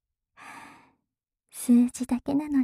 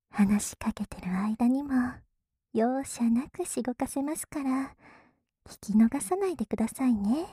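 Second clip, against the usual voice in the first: whispering. Acted. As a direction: tease in a hushed whisper. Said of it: "ささやいてる反対のお耳から".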